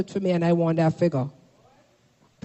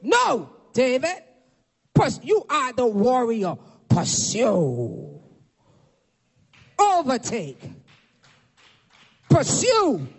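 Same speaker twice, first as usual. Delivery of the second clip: solemn spaced-out orders, each one falling; preacher lightly voicing God's firm commands. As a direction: issue each order as a divine decree. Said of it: "God says".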